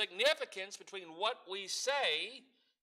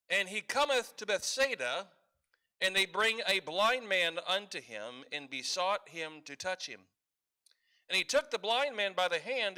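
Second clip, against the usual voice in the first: a touch louder formal Bible reading; scripture reading; 3 dB louder than usual. Acted. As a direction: read the verses out with weight. Reads scripture passage aloud.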